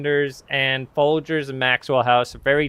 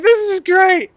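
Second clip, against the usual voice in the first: much higher, louder delighted exclamation; excited; 4 dB louder than usual. Retold or imitated. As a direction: burst out with delight. Commander showing up for coffee.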